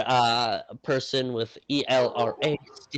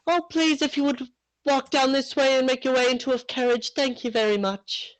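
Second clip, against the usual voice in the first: put-on high, polite British girl voice; a posh British welcome in a high voice; young guide ushering guests, high British politeness; 13 semitones higher than usual. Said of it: "There she goes".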